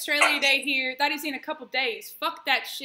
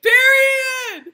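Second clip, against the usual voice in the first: high, very loud catchphrase yell; real excited whoop; triumphant shout; 10 semitones higher, 12 dB louder than usual. Real, not acted. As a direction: belt it out in agreement. Cheering the queen remark.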